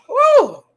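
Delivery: big loud belch; burping after fizzy drink. Real, not acted. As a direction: let out a long burp. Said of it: "Sorry for burping".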